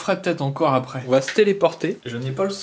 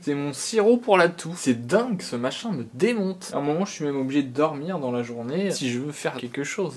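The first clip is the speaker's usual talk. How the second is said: ordinary talk, nothing marked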